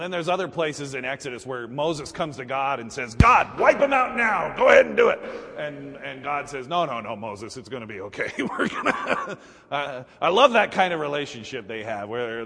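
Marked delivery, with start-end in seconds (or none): 3.1-5.2 high, loud urging; impatient shouted demand; vengeful Moses. Acted. Moses urging God to smite. 6.7-9.4 soothing God, laughing. Acted.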